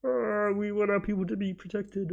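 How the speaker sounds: a hint of a smug YouTube spokesperson; mock corporate voice. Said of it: "'cause they're like".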